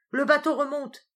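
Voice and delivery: loud, high and joyful; joyful cry. Acted. Dudored telling the crew the boat rises, joyfully. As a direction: cry out in relief and joy. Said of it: "cria Dudored d'un accent joyeux".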